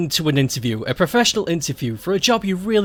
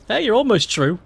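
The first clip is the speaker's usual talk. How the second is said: normal talking voice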